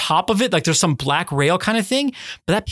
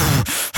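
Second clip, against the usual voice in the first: hard angry exhale; fuming huff; 4 semitones lower than usual. Retold or imitated. Driver coming round to look at the window, fuming huff. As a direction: huff out air in mock fury. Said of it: "like, fuming".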